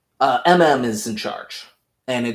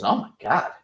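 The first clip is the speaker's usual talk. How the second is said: mock shock; 6 semitones higher than usual. Homelander feigns shock at Butcher.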